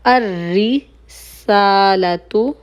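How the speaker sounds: अर रिसालतु drawn out very slowly with a doubled r; slow careful pronunciation. Shown as a demonstration. Teacher slowly modelling अर रिसालतु for students. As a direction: stretch every sound of अर रिसालतु for the class. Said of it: "ये होगा अर रिसाला".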